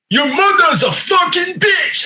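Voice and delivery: high, drawn-out mock yell in a Japanese accent; mock angry insult. Acting man's parting insult in accent.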